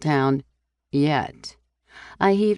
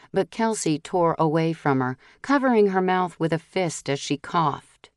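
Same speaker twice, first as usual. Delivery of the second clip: ordinary delivery, nothing marked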